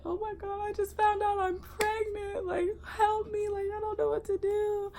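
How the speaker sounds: high, wailing sobbed plea; retelling her panicked sobbing to the guard; crying plea. Retold or imitated. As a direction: cry it out in a high broken voice. Crying to security guard, retold.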